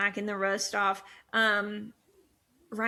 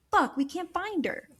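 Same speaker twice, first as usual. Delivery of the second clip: mock exasperation; 8 semitones higher than usual. Acting out team who lost her.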